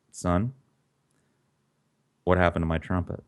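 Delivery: 1 quiet simmering anger. Retold or imitated. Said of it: "simmering, quiet anger".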